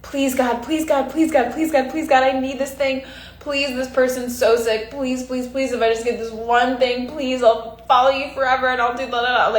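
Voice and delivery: high pleading, begging God; anxious hope. Retold or imitated. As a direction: beg desperately, voice up high. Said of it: "what many of us do is".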